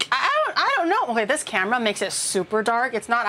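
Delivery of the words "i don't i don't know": high, worried fretting; anxious. Real, not acted. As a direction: fret nervously, voice up. Worried about burnt rendang.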